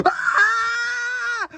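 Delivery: high startled ah; frightened shriek. Acted. Toy startled by the monster, high frightened shriek. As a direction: yelp as if something jumped out. Scared by Cartoon Cat.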